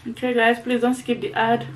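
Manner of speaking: chewing while talking; words between bites; mouth full. Real, not acted. Seen 0: bites food at -1.0, then talks with lips slack, eyes closed.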